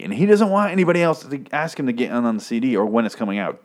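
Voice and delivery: ordinary delivery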